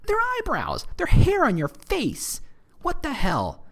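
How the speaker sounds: high-pitched, comic incredulous protest; be theatrically baffled, pitch leaping way up; mock disbelief. Mock disbelief at liking eyebrows.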